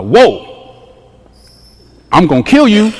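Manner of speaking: loud mock threat; God. God warning the people, loud mock threat. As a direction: boom out a blunt warning. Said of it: "that's God".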